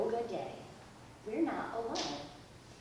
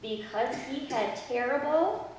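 repeat-after-me prayer; 4 semitones higher, 7 dB louder, slower than usual. Acted. Leads kids in repeat-after-me prayer.

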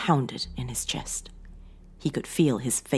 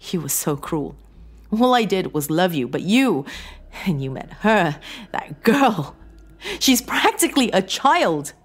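hurt reproach climbing high and loud; wounded wife, increasingly worked up; tearful accusation; 6 semitones higher, 6 dB louder than usual. A narrator's reading. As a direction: accuse through tears, building to an outburst. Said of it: "Her tears began to flow".

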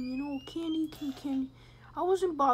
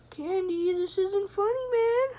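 louder, higher, rising plea; worried protest; 7 semitones higher, 7 dB louder than usual. Pretend. Player to silent Candy, mock-worried protest. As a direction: raise the voice more with each phrase. Pleading with unresponsive game character.

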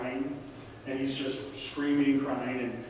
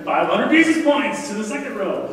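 higher, much louder announcer-like call; mock game-show award; 7 semitones higher, 9 dB louder than usual. Preacher awards quiz points to the second row, loud and playful. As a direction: hand out the prize with a playful shout.

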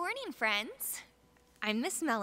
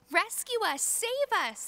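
much higher desperate cry; frightened shriek; 11 semitones higher, 4 dB louder than usual. Acted. The disciples shrieking to Jesus for rescue, frightened shriek. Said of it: "they shrieked".

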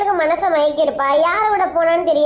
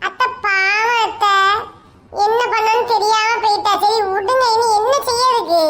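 put-on higher, gently pleading young man; pleading son-in-law; 5 semitones higher than usual. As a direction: coax the angry aunt to forgive.

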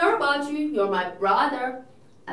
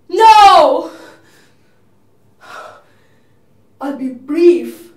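loud, high anguished cry; desperate cry; 5 semitones higher, 16 dB louder, much slower than usual. Acted. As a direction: fling it out in despair. Juliet before the dagger.

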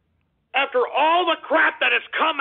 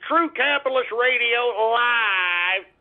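announcer slogan; slower than usual. Acted. Repeats show name slowly.

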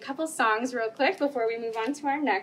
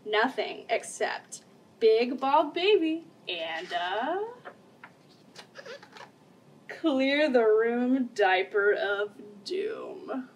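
wrestling announcer reading the book; slower than usual. A narrator's reading. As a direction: call it out like a ring announcer.

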